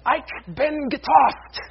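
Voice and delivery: high, slow, forceful German shout; angry German voice. Acted. Preacher showing how German always sounds angry, fierce drawn-out shout. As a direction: bellow it slowly in angry German. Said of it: "It just always sounds angry".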